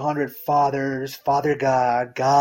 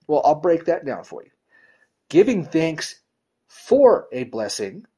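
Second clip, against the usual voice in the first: normal talking voice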